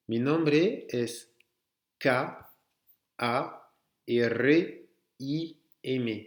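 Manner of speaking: Mi nombre es said slowly, then letters one by one; slow spelling demo. Shown as a demonstration. Teacher spelling his name to show them, slowly. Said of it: "je vais le faire juste avant pour vous montrer".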